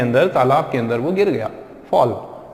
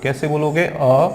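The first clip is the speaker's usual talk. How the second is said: ordinary delivery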